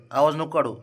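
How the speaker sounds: normal talking voice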